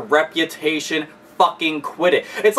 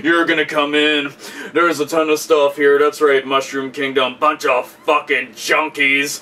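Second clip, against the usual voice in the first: mock police raid voice; 4 semitones lower than usual. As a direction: play a TV cop reading out the bust. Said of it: "and they're just like".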